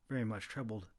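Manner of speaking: ordinary delivery, nothing marked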